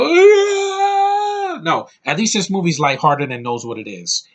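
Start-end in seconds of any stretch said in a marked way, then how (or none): 0.0-1.6 long, high, loud wail; hammed-up anguished cry held on one high note; mock crying scream. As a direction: let out an exaggerated wailing scream. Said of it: "and cry and go".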